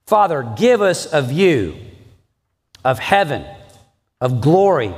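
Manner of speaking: earnest prayer lifting toward heaven; pastor's prayer. Acted. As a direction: pray it over the congregation. Pastor leads closing prayer.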